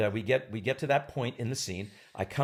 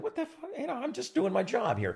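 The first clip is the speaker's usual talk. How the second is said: muttered incredulity; 12 semitones higher, 3 dB quieter than usual. Retold or imitated. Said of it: "I said".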